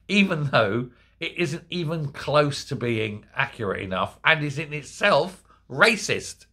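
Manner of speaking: ordinary talk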